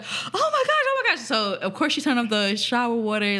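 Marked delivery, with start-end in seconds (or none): 0.0-1.2 very high, squealed alarm; shrieky mock horror; retold shocked cry. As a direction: imitate a shocked discovery, pitched way up. House parent finds girl on floor.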